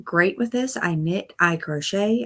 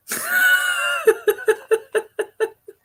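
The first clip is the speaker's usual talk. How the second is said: loud high laugh; 4 dB louder than usual. Real, not acted. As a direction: burst of shrieking laughter, much higher. Laughing at looking shed.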